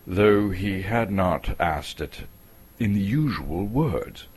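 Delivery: plain reading voice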